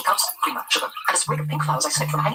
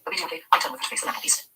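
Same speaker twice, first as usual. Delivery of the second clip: usual speaking voice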